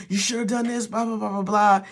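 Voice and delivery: lightly lampoon a parent nagging in the car; mock nagging parent. Parent nagging the kid on the school drive, mocked. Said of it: "they're yelling".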